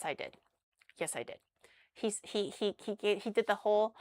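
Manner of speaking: usual speaking voice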